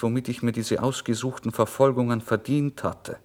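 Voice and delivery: plain reading voice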